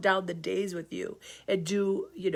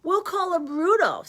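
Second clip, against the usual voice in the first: proud papa reindeer; 9 semitones higher, 3 dB louder than usual. Acted. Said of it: "said Donner".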